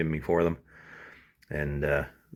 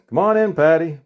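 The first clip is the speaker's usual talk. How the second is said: higher, louder call through the door; manager yells; 9 semitones higher, 8 dB louder than usual. Acted. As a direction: call her in from the office.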